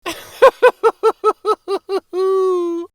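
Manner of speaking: high, loud long laugh; hearty laugh. A narrator's reading. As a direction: laugh at the joke. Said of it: "He started laughing".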